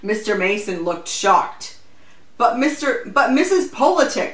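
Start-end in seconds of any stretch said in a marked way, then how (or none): 2.4-4.3 high, flustered objection; protesting. A narrator's reading. Shocked official objecting to the volunteer, protesting. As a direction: stumble into a protest, pitched up. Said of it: "he protested".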